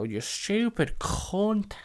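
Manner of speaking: drawn-out, high mock jeer; sneaky kids' parting insult, stretched out high; mimicked snide kids. Kids jeer at the woman as she leaves, mock snide taunt. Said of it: "you just hear the kids".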